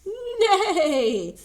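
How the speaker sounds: loud, high, pleased whinny; pleased neigh. A narrator's reading. Horse neighing with pleasure at the chicks. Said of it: "said Horse with pleasure".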